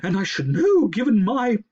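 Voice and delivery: high, loud owlish voice; a haughty owl protesting; high hooting owl. Acted. Owl character voice.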